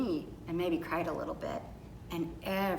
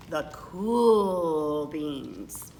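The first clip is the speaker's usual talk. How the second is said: lower, very slow and laid-back; low, slow cool-guy drawl; 5 semitones lower, much slower than usual. A narrator's reading. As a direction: stretch it out like the coolest kid in school. Cool kids' grand entrance.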